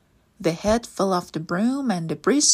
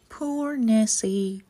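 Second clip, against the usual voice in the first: usual speaking voice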